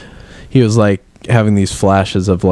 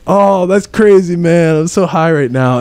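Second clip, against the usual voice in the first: stoner voice; 10 semitones higher than usual. Pretend. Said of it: "they're like".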